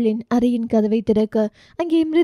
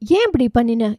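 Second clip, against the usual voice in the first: ordinary delivery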